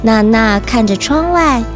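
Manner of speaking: usual speaking voice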